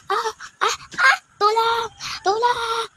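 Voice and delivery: high crying wails of hurt. Acted. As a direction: sob out the cry of being pushed. Crying after being pushed. (umiiyak nang mataas)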